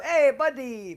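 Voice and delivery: high, loud mock Indian accent; Indian accent impression. Son greeting gas station clerk, high mock Indian accent. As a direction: holler it in a put-on Indian accent. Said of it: "my son even does the accent".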